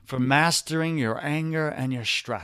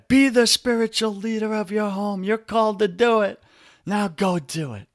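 mock preacher; 6 semitones higher than usual. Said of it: "We just hear from the pulpit".

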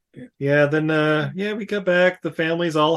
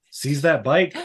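ordinary talk, nothing marked

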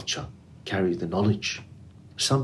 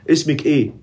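Egyptian dialect, higher and louder with a laugh behind it; Egyptian Arabic accent; 7 semitones higher, 5 dB louder, much faster than usual. Shown as a demonstration. Interviewer playfully testing guest in Egyptian Arabic accent. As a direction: ask her name the Egyptian way, playfully. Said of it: "I will use Egyptian accent".

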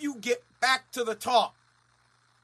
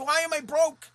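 whiny mock complaint; 6 semitones higher, 6 dB louder than usual. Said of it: "people continue to waste money and they say".

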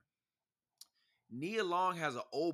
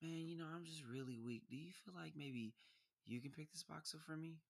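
soft, breathy-sounding flirty ask; flirty sweet voice; 3 semitones higher, 14 dB quieter than usual. Retold or imitated. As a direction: sweet-talk him into lifting the box. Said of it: "some women be like".

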